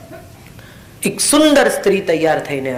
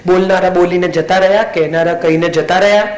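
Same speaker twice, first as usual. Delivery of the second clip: normal talking voice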